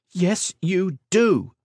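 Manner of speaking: high, slow, heated contradiction; hot retort. Acted. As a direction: throw it back at her father with heat. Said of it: "she contended hotly".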